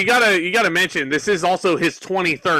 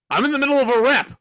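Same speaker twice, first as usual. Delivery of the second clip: higher, quicker put-out huff; mock annoyed Masters; 6 semitones higher, faster than usual. Masters, irritated at the interruption, mock huffy. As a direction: Masters scolding Carlito for barging in. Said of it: "and Masters was like".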